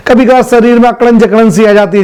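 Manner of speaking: usual speaking voice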